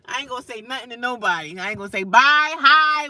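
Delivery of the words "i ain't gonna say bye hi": excited. Real, not acted. Excited loud.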